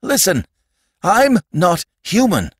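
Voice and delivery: high, urgent insistence; gasping plea. A narrator's reading. Sandman just kicked by the boy, urgently pleading. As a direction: insist desperately in short bursts.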